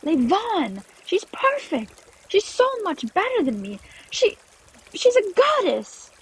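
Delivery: high, overwrought admiration; worked-up and near breaking; distraught raving. Acted. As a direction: gush in despair, slowing at the end. Clover raves about rival, distraught.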